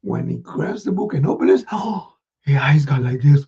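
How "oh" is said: plain reading voice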